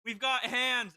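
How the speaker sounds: loud, high in-character shout; yell. Pretend. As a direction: call it out loudly to the group. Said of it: "I'm going to yell".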